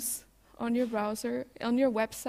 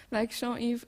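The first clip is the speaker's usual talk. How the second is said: ordinary talk, nothing marked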